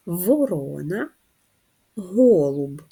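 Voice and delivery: each syllable drawn out slowly; slow clear model word. Shown as a demonstration. Teacher models Ворона slowly for pupils. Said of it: "Давайте ще раз промовимо ці слова".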